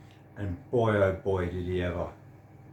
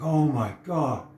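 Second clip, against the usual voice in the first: overwhelmed exclaim; 5 semitones higher, 5 dB louder, slower than usual. Retold or imitated. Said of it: "And I'm like".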